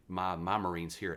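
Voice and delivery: ordinary talk, nothing marked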